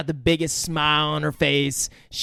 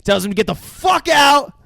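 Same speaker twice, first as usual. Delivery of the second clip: excited loud retelling; 9 semitones higher, 6 dB louder than usual. Retold or imitated.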